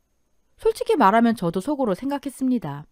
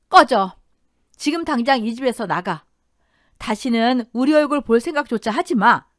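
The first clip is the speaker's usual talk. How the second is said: loud first word, then firm command; stern, furious wife; angry dismissal; 2 semitones higher, 5 dB louder than usual. Acted. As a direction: order him out of the house. Wife throws husband out.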